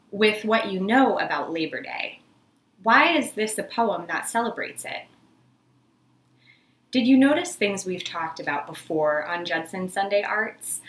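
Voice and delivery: normal talking voice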